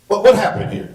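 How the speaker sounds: high, loud, sharp demand; imitating the sergeant grilling the child. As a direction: snap the question like a fed-up officer.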